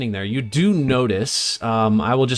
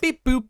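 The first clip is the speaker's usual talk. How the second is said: squeaky high computer beeps; high beep boop; 14 semitones higher than usual. Pretend. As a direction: chirp it high like a toy. GM echoes the beeps.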